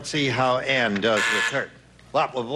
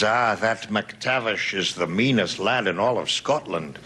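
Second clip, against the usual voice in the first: a lightly put-on Scottish brogue, low and slow; hint of a Scottish burr; Scots accent; 7 semitones lower, slower than usual. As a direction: play a mean old Scot. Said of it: "Wonderful accent".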